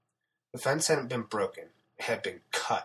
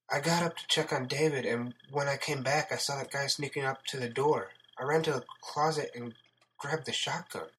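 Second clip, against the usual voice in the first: higher-pitched voice for a female character; shaken woman recounting events; 4 semitones higher than usual. Acted. Sarah recounts what happened.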